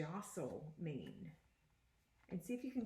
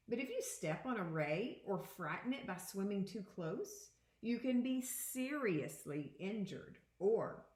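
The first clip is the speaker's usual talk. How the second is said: put-on expressive read-aloud to children; picture book read to students; reading aloud to a class; 3 semitones higher, 3 dB louder than usual. Teacher reads picture book aloud.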